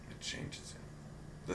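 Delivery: slow ominous whisper. Acted. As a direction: breathe it out slowly. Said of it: "whispered repeat".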